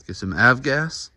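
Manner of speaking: normal talking voice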